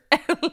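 short bright burst of laughter. Real, not acted. Mom laughing at her dirt recipe, a bright laugh. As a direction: laugh out loud at the punchline.

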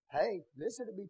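ordinary talk

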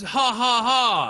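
loud, high villain cackle; mustache twirling villain. Retold or imitated. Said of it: "actively says".

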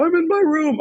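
high, whiny mock distress; panicking buddy. Distraught buddy answers where he is, high mock wail. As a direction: imitate a distraught caller, voice way up. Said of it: "they're freaking out, panicking".

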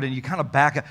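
usual speaking voice

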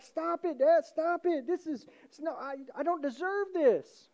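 high, loud embarrassed protest; acted pleading, pitch way up; protesting son. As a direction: beg him to stop, overwhelmed. Undeserving son protests father's welcome.

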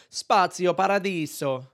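overdone Italian flourish on Spazio Paradiso; full-hog Italian accent. Shown as a demonstration. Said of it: "Do you go full hog".

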